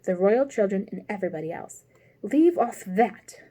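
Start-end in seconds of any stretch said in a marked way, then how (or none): 2.2-3.4 loud, slow mock scream. A narrator's reading. Queen screams at the bowing gardeners. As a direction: yell it slowly. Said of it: "screamed the queen".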